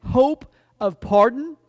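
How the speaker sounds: loud, slow, emphatic refrain; impassioned preaching. Real, not acted. Preacher proclaiming hope to the congregation, loud and measured. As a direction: proclaim each hope deliberately, loudly.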